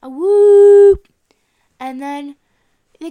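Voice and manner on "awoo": very loud, high, sustained "awoo"; werewolf howl. Pretend. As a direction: let out a full-volume wolf howl. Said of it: "Classic werewolf".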